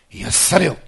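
loud healing command at high pitch; commanding shout. Real, not acted. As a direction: proclaim the healing with full force. (力いっぱい叫ぶ癒しの宣言)

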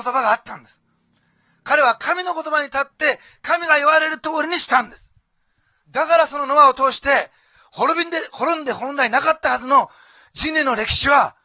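loud, high, impassioned sermon delivery, building to a shout; full-force preaching. Acted. Pastor urging the congregation about Noah's faith, preaching loud and impassioned. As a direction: drive the point home to the congregation.

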